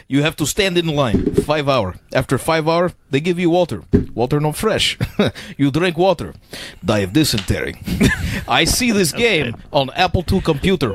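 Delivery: lightly put-on Russian accent, broken English; a hint of Russian, played for laughs; mock Russian accent. Friend shows off his Russian accent for the group, lightly mock Russian accent. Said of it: "I think I do an okay Russian accent".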